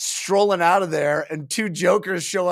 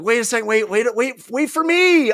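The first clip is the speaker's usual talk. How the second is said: high, urgent calling, rising to louder; mock panicked pleading; 8 semitones higher, 4 dB louder than usual. Troll calling after a companion going back in, mock panic. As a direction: plead to be waited for, hammed up.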